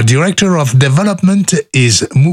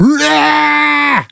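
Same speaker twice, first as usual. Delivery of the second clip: high shrieking laugh; 14 semitones higher than usual. Real, not acted. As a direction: burst of squealing laughter. Big laugh after outtakes.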